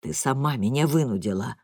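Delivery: deep, deliberate dad; father blaming mother, low and slow. A narrator's reading. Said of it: "медленно отвечает папа низким голосом".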